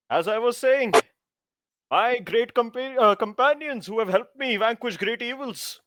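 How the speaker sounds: high-pitched, self-important hero oration; grand speechifying. Acted. Self-styled hero resuming a speech to the juniors, grandly. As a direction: address followers like a pompous little king.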